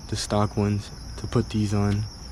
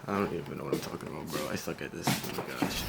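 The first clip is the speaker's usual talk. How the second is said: muttering to himself; 7 semitones lower, 8 dB quieter, faster than usual. Real, not acted. Frustrated aside, filming fumble.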